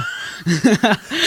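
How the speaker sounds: a short rising laugh. Real, not acted. Teen laughs to host about his family, laughing. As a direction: let a laugh burst out.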